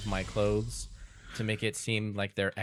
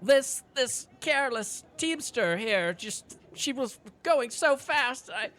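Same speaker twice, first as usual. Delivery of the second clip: very high, slow, pitiful whine; feigned distress. Acted. Fake victim blames the teamster to the guard, feigned distress. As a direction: play the hammed-up helpless victim.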